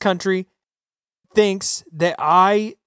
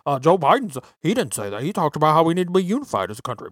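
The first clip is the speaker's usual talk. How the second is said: mock leftist; 5 semitones lower, faster than usual. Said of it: "they're gonna tell you".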